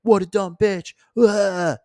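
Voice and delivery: high, slow mock agreement with a disgusted ugh; mocking friends. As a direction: ham up friends piling on. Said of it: "your friends are like".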